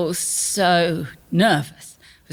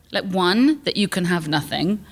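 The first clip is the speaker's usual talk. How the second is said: normal talking voice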